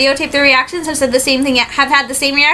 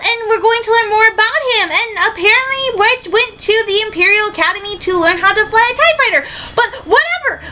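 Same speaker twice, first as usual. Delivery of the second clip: much higher, gushing fangirl squeals; real excitement; 7 semitones higher than usual. Real, not acted. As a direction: bounce with delight about Wedge. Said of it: "I was excited to see Wedge".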